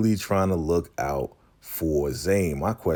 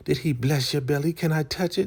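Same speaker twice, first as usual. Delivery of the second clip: high, eager nosy elder voice; Elder Mother Marva; 10 semitones higher, faster than usual. Retold or imitated. Elder wants to touch Ruth's belly, high prying voice. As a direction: beg nosily in a high put-on voice. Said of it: "Elder Mother Marva's like".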